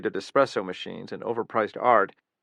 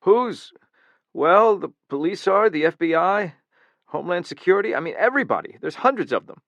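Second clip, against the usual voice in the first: high, loud frightened babble; panicked, voice breaking upward; voice cracking; 8 semitones higher, 6 dB louder than usual. Acted. Guard to the killer, voice cracking high in fear. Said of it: "Wall's voice cracked".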